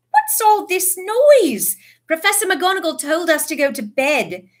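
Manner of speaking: put-on high complaining girls; girl voices. A narrator's reading. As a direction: whine it like annoyed girls.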